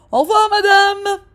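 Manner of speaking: high, loud sarcastic farewell; a man taunting loudly; mocking goodbye. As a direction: jeer at the dad.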